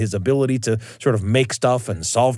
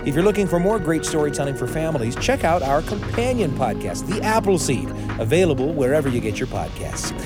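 ad read; 4 semitones higher than usual. Acted. Promo read.

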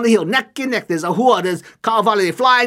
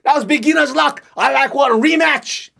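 a touch of annoyance, higher; angry demand; 7 semitones higher, 3 dB louder than usual. Acted. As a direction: demand the rematch, sore loser. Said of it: "He all angry".

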